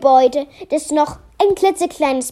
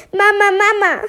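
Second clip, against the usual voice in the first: put-on higher-pitched child's call; 7 semitones higher than usual. A narrator's reading. Melia calls her mother on getting home, calling out. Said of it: "rief sie".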